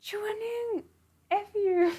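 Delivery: a high mock curse; mock outburst. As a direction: spit the insult out. Reading an angry character line.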